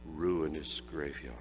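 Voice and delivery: ordinary delivery, nothing marked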